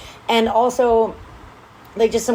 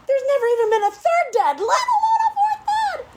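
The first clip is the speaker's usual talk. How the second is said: very high-pitched squeal of exasperation; exaggerated disbelief. Real, not acted. Host ranting about the book's plot, squealing exaggerated disbelief. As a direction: squeal it in comic outrage.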